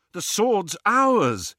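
high, loud, slow assertion; insistent claim. Acted. Quarrel over who owns the sword.

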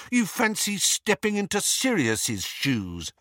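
high, taunting accusation; rage. A narrator's reading. Harry goading livid Lupin, angry and high. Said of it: "did not know where his rage was coming from".

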